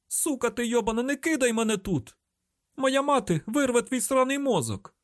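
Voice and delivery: very high, furious panic; shrieked high-pitched threat; hysterical screaming. A narrator's reading. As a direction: curse frantically at your rescuer. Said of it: "Не кричи".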